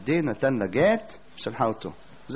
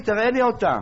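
challenging demand; 7 semitones higher than usual. Retold or imitated. The teacher challenging the man to show his soul, high and incredulous.

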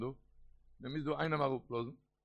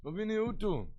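raised-pitch call without extra volume; mild high outcry; acted prayer cry; 5 semitones higher than usual. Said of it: "די געשריי".

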